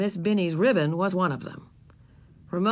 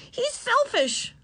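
put-on little girl voice; much slower than usual. A narrator's reading. Ramona voiced.

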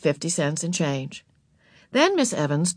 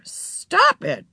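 higher, exasperated command; crying out; 13 semitones higher, 3 dB louder than usual. A narrator's reading. Mother stopping the sisters' fight, high sharp command. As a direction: break up the quarrel with a high cry. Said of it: "cried Mother".

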